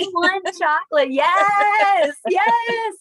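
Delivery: high and loud, thrilled praise; excited. Real, not acted. Teacher cheering a student's new sentence, excited.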